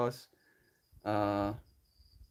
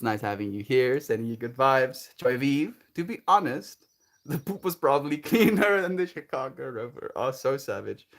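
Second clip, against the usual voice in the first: normal talking voice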